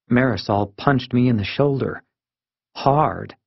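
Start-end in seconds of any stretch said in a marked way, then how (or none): none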